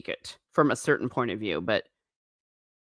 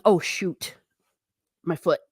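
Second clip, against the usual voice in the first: ordinary talk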